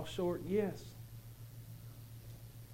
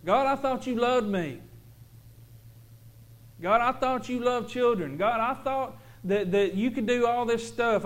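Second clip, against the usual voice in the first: high, loud desperate plea rising to a cry; pleading cry to God; 7 semitones higher, 8 dB louder than usual. Retold or imitated. Father crying out to God for his daughter, desperate. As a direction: beg God with everything, near breaking. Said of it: "So I'm sitting here whining".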